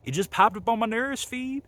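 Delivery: high-pitched imitation of a Memphis accent. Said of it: "my favorite thing about [name] is the accent".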